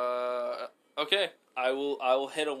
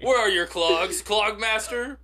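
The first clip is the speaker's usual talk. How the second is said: mock king demand; 7 semitones higher, 7 dB louder than usual. Acted king scene.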